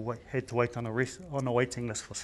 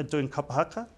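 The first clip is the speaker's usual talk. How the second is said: ordinary talk, nothing marked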